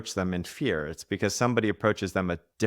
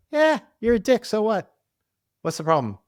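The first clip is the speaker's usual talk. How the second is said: much higher, playful mock voice; loving teasing friend; 6 dB louder than usual. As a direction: tease him fondly, full of affection. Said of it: "he was coming from a place of love for me".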